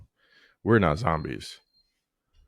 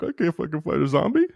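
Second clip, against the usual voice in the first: high, whiny imitation of a tearful son; hammed-up tearful protest in a raised pitch; mock crying kid; 9 semitones higher than usual. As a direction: pretend to be a boy about to cry. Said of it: "he's gonna start fucking crying".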